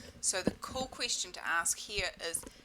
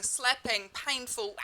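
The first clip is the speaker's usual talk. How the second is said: normal talking voice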